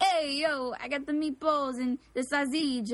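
Italian-American street caricature. Acted. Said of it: "what an Italian person act like".

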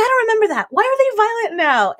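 very high-pitched mock wail; baffled mom. Luca's mom asking about the fighting boys, baffled. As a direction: re-enact an exasperated, baffled mom.

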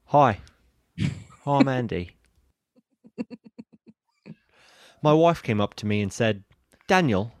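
put-on Australian-style accent, measured and deliberate; mock meditation-app host with an Australian accent; Andy from Headspace. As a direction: tell the joke in a put-on Australian accent, with long pauses. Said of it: "your Andy voice from Headspace".

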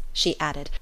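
ordinary delivery

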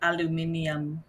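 put-on lightly done Serbian accent; aluminium in a heavy Serbian accent, soft. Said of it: "in, like, a heavy Serbian accent".

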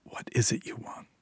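soft whispered hiss; quiet hiss. Acted. Julia to Syl who holds her baby, hissing quietly. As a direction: hiss it under your breath. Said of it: "quietly hissed".

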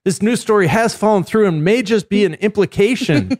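high-pitched live street report parody; mock field reporter. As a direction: report breaking news live from the scene. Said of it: "[name]'s on the street corner at the location".

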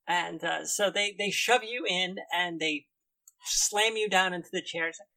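ordinary delivery

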